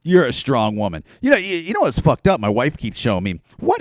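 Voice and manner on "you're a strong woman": mock patronizing. Mocking left-wing fans comforting the comedian, low and patronizing. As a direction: hand out hollow praise. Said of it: "pat her on the head and go".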